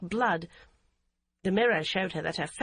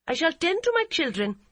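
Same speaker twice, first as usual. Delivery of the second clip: high, louder defiant reply; defiant young mother; 9 semitones higher, 5 dB louder than usual. A narrator's reading. Daughter-in-law defying her, raised high.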